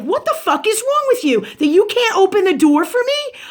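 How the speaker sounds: high-pitched retold outburst; replaying an angry confrontation; indignant retort. Acted quarrel retold to listeners.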